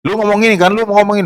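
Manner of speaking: high-pitched imitation of someone pressing a point. Retelling Edis cornering RK in debate, pressing. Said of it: "Edis ndesak dia".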